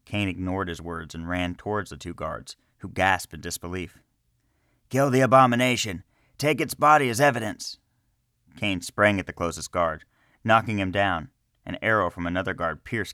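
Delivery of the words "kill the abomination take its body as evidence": loud, high battle command; shouted order. A narrator's reading. Guard ordering the others to kill the wolf, loud shouted command.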